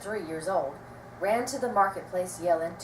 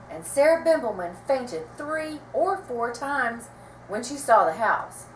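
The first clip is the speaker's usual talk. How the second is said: plain reading voice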